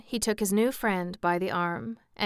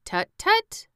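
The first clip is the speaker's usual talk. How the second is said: high-pitched, louder tut-tutting; cautioning; 14 semitones higher, 6 dB louder than usual. A narrator's reading. Scarecrow stopping Jack's outburst, high cautioning tuts. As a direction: tut in reproof, pitch jumping up. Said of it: "cautioned the Scarecrow".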